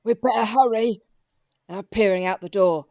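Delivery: ordinary delivery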